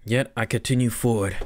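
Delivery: usual speaking voice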